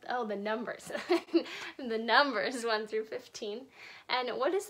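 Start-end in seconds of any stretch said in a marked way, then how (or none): 0.9-1.7 short amused laugh. Real, not acted. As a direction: laugh at the slip. Laughs at own slip.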